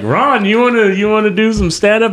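mock host invite. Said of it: "and they're like".

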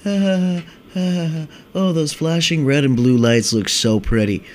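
dazed stoner. Retold or imitated. Mocking a stoner staring at police lights, mock dreamy stoner. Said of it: "Don't be like".

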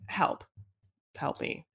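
whispered, helpless little plea; faint, voiceless mock cry for help. Mock plea at the friend's look.

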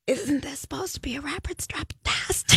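hoarse, voiceless whisper; raspy whisper. Real, not acted. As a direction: whisper it, voice gone. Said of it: "speaker has laryngitis, no voice".

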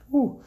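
high, loud whew of relief; sigh of relief. Real, not acted. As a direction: blow out the relief. Relief after close call.

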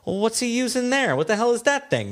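high, baffled wondering; puzzled. Retold or imitated. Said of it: "I'm like".

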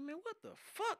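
high-pitched stunned exclamation; retold disbelief. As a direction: sound like you can't believe your eyes. Retells waking to police outside.

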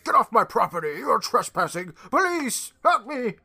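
high, loud hammed-up outrage; mock an old guard yelling for help; cranky old man. Guard ordering kids off his property, hammed-up cranky shouting. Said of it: "cranky for no reason".

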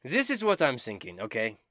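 high, loud and theatrical; Dobin, eager bard. Acted. Dobin bragging about dragon slaying, high and loud. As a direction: ham up the vainglorious bard.

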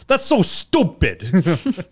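put-on slow, thick New York accent. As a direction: drawl it like a New Yorker. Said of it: "in his very thick New York accent".

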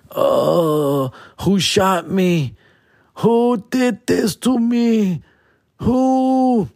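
high, slow, crying whine; hungover wailing. Acted. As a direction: wail it like you're dying.

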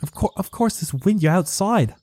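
put-on high, British-accented villager; British-sounding villager. Farmer answers panicking stranger, British-accented and baffled. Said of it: "Your accent is, like, British".

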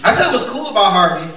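usual speaking voice

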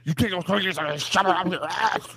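high, loud hammed-up threat; mock furious yell. Acted angry candidate yelling back.

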